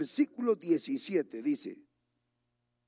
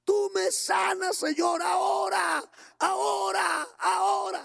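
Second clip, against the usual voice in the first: crying out to God; 12 semitones higher, slower than usual. Retold or imitated. Sick brother at the altar pleading to God, shouting. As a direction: beg the Lord to heal you right now. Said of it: "Así clamaba".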